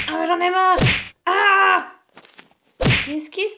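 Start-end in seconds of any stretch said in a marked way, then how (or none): 1.2-1.9 loud, high pained yelp; cry of pain. Acted. Boy hurting his hand on the wall, loud pained yelp. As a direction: let out a sharp yelp of pain.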